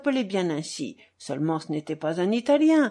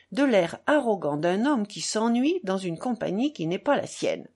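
ordinary delivery, nothing marked

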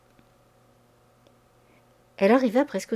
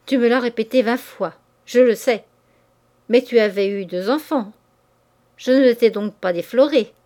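louder, harsh and scornful; cutting her off loudly; 5 dB louder than usual. Acted. Lover cutting off his mistress's lament, harsh and loud. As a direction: interrupt her, hard and cruel. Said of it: "Il lui coupa la parole".

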